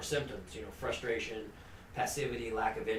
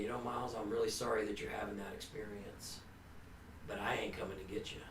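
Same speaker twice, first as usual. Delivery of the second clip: softer, low, steady refusal; firm but gentle, ending almost in a whisper; very calmly; 4 semitones lower, 7 dB quieter, faster than usual. Retold or imitated. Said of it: "he said very calmly".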